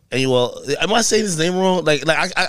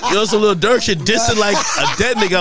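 laughing; 6 semitones higher than usual. Real, not acted. Laughing at the misunderstanding.

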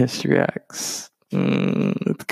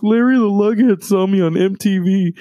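imitating her excited mock Southern accent, higher and louder; 9 semitones higher, 5 dB louder than usual. Imitating the sister on MTV, mock Southern accent. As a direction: ham up a Southern accent as she gushes. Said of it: "in almost, like, a mock Southern accent".

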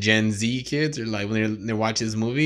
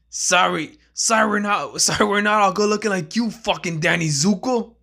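higher, fast sarcastic apology; mock apology; 8 semitones higher, 3 dB louder than usual. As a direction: sneer it at the pretty boy. Friend mocking Danny.

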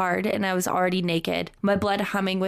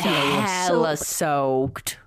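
very slow, stretched-out joking delivery; drawn-out mock echo; 3 semitones lower, much slower than usual. Host echoes co-host's joke about the story, comically drawn out. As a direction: drag out every vowel for laughs.

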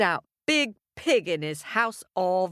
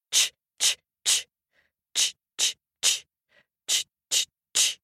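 cutting noise; faster than usual. A narrator's reading. Axe cutting straw.

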